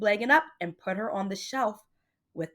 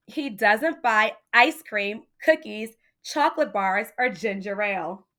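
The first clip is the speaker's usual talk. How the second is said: put-on lively read-aloud for children; story reading; 4 dB louder than usual. A narrator's reading. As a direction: read it like a bedtime picture book. Reading a picture book aloud to children.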